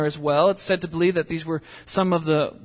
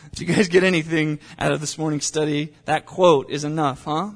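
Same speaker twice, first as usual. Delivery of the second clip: ordinary talk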